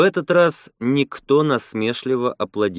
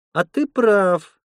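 higher, drawn-out, easy agreement; 9 semitones higher, slower than usual. A narrator's reading. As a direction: agree readily, stretching the words in a raised voice. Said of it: "легко согласилась Лена".

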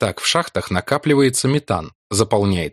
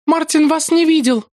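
put-on young woman voice; 4 dB louder than usual. Ruth's voice held.

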